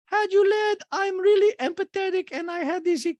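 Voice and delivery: piercingly high, loud caricature; mocking quote. Host quotes housewife claiming empathy, very high loud mock voice. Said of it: "oh, sorry".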